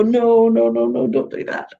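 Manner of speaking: imitated protest with drawn-on noes; interns' chorus of protest. Interns hearing someone put on a phony accent, mock protest. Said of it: "They all go".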